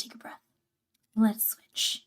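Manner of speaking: soft breathy whisper. Real, not acted. Catching breath after talking.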